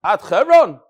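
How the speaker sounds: loud, punched question; acted question. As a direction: fire the question up to the roof. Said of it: "asks him a question".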